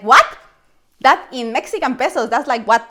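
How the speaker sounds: shocked disbelief. Retold or imitated. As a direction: react like the price is absurd. Shocked at water prices.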